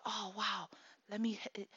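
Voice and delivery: soft, breathless whisper of wonder; awed whisper. Retold or imitated. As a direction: hush it like a kid afraid to break the spell. Child excited over new boombox.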